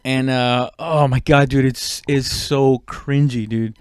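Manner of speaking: usual speaking voice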